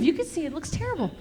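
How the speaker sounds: ordinary talk, nothing marked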